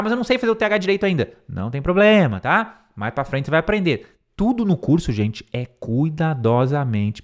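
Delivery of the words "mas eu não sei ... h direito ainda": higher, fast anxious learner; mock student complaint; fretting student. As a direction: rush it like a worried student. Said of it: "aí o som do T H".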